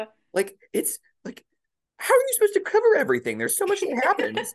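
high, fast, breathless overwhelm; squeaky exasperated rush; frantic exasperation. Real, not acted. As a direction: rattle it off, overwhelmed and laughing. Frantic after rushed recap.

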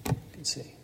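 soft whispered healing words; Jesus quietly healing the blind man. Acted. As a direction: breathe it out like a secret. Jesus heals the beggar.

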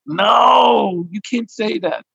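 high, drawn-out "No"; protesting. Acted. Husband protests at wife's accusation.

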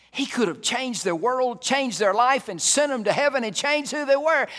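very loud, high-pitched pulpit cry; soaring, shouted sermon; full-force preaching. Real, not acted. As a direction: pile it up at the top of the voice.

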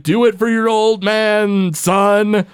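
hammed-up cartoon dad cheering his son; Homer impression. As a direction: call it out proudly from the stands. Homer cheers Bart from the crowd.

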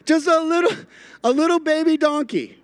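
put-on cutesy baby talk. Preacher keeps gushing about the baby donkey, high cooing voice. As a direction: gush over the tiny donkey like a baby. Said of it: "I don't know why I just said it like that".

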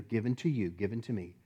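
ordinary delivery

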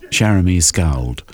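usual speaking voice

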